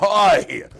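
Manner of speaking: a drawn-out loud oy vey; loud oy vey groan. Retold or imitated. Congregant groaning at bad news with a loud oy vey. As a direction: moan it like a dismayed congregant.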